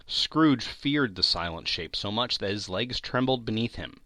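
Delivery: ordinary delivery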